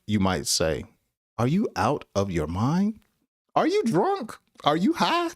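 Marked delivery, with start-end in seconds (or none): 1.4-5.3 incredulous questioning. Acted. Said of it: "you're basically insulting someone".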